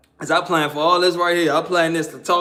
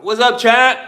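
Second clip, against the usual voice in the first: loud, higher greeting; calling out; 4 semitones higher, 6 dB louder than usual. Real, not acted. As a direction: break off to hail someone loudly. Calls a greeting mid-story.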